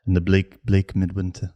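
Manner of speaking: low Brummy gangster murmur; Thomas Shelby. Acted. Co-host quoting Peaky Blinders, Thomas Shelby voice. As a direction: play it low, in a Brummy accent. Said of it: "then you do the Thomas Shelby".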